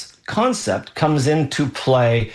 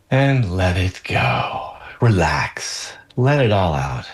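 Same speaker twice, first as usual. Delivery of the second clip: guided breathing; 3 semitones lower than usual. Shown as a demonstration. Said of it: "breath work is necessary right now".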